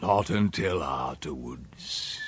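low, soft, slow and ominous; dark, brooding echo. A narrator's reading. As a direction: repeat it darkly, sinking away. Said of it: "he said darkly".

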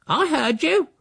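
very high screechy retort; angry accusation. A narrator's reading. Housekeeper accuses the uncle, angry screech.

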